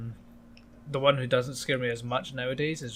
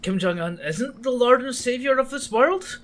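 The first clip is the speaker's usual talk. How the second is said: high, slow mock disbelief; feigned outrage, rising high; mock shock; 11 semitones higher than usual.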